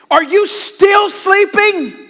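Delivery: very high, slow, stretched disbelief; incredulous. Shown as a demonstration. Jesus finding the disciples asleep again, high slow disbelief. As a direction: drag out the disbelief. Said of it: "I kinda read it as".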